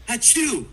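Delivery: hammed-up fake sneeze; a mock, theatrical achoo. Fake sneeze, contagious joke.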